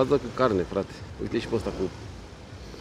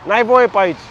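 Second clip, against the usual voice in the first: loud, raised scolding; angry yell; 6 semitones higher, 5 dB louder than usual. Real, not acted. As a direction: tell him off sharply. Yelling at rider on bike path.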